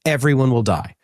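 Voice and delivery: ordinary talk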